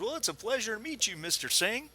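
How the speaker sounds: Arizona Joe. Acted. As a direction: rattle off a warm cowboy welcome. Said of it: "He goes".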